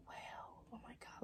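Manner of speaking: breathless whispered disbelief; stunned whisper. Retold or imitated. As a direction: whisper it, frozen in shock. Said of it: "Like that".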